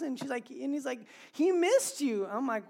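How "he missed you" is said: high, loud imitation of a protesting wife; pleading rebuke. Wife to dad about their son, pleading reproach. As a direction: plead the child's case, voice raised.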